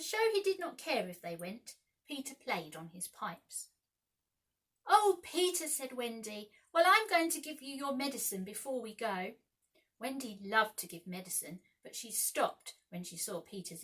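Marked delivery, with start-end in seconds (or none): 4.9-5.7 high, loud plea; pleading. A narrator's reading. Wendy pleading with stubborn Peter, high and loud. 6.7-9.4 motherly. A narrator's reading. Wendy insists kindly.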